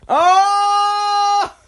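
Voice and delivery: high, loud mock outrage; outraged shout. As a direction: cry out as if wounded. Outraged reaction to insult.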